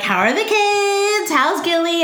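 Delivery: mock chirpy small talk.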